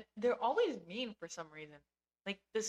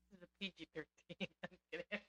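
ordinary delivery

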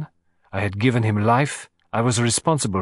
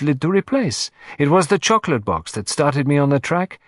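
higher and quicker, self-reproaching Poirot; animated; 5 semitones higher, 3 dB louder than usual. Acted. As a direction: burst out with animated frustration. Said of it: "becoming suddenly animated".

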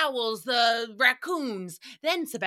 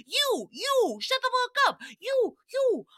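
angry yell; 14 semitones higher than usual. Retold or imitated. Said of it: "And he's like".

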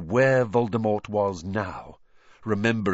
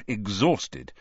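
usual speaking voice

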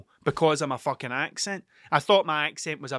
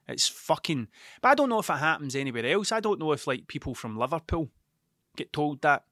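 normal talking voice

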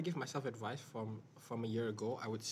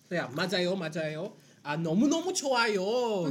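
playful Korean; 6 semitones higher, 9 dB louder, faster than usual. Pretend.

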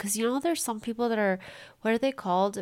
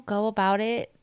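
normal talking voice

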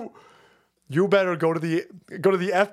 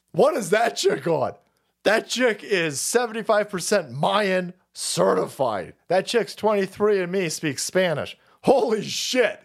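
normal talking voice

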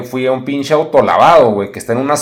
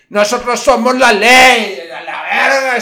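higher, louder, slow boast with a laugh; 7 semitones higher, 6 dB louder, slower than usual. Retold or imitated. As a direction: lord it over her laughing.

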